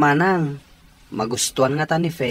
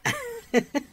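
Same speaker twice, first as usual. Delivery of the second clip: soft laugh; 4 dB quieter than usual. Acted. [name] laughs after surprise.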